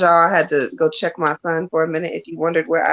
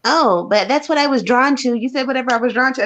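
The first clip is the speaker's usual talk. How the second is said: put-on higher, quick whiny kid protest; imitating her son; 6 semitones higher than usual. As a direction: play the pleading son, rushed and high. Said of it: "So he was like".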